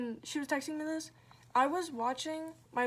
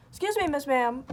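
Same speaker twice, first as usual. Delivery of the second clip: louder, sassy telling-off; indignant; 2 semitones higher, 7 dB louder than usual. Retold or imitated. Answering someone texting in all caps, loudly indignant.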